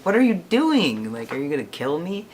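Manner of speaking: high, loud startled outburst; half-joking panic at the scissors; alarmed surprise. Retold or imitated.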